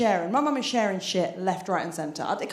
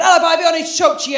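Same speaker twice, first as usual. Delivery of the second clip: imitating dad; 5 semitones higher, 10 dB louder, faster than usual. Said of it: "He said".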